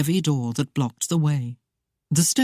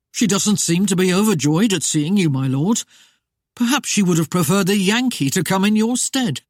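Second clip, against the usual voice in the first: feigned sympathy; 4 semitones higher, 4 dB louder than usual. Acted. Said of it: "feigned a look of sympathy".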